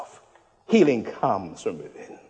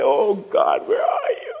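high anguished cry, breaking; crying out to God; 8 semitones higher, slower than usual. Pretend. Believer crying out to God, high anguished wail. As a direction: wail it up toward heaven. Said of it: "Have you cried recently ... and say".

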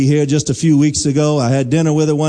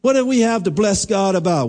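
ordinary talk

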